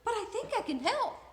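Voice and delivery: put-on high, quick, sweet old lady; cheery old woman. Old woman offering help, high, quick and sweet. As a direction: bubble with helpful cheer.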